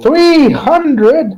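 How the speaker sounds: booming high outburst, drawn over each word. Acted. Customer balking at the bottle's price, booming. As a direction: boom it out in mock disbelief. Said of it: "Booming voice, just fucking three hundred at top of my lungs".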